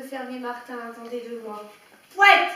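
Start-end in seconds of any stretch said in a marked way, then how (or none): 2.1-2.5 the name bellowed much higher and louder; bellowing. A narrator's reading. Farmer bellowing at the dog barking at the horse, loud roar. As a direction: roar the dog's name across the field. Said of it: "vociféra-t-il".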